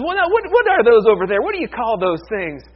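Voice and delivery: high, loud, acted astonishment; hammed-up bewilderment at a strange sight; baffled farmer. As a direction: stammer it out in mock amazement, pitch jumping up. Baffled Texan sees kangaroos.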